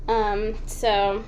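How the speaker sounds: usual speaking voice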